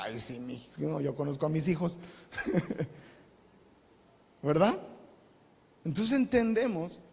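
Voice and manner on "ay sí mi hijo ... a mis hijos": soft, mock-naive parent's voice; lightly mocking doting parent. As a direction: play the blind parent, softly. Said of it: "Los papás".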